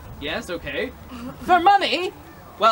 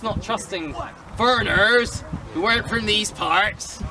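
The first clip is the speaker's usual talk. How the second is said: usual speaking voice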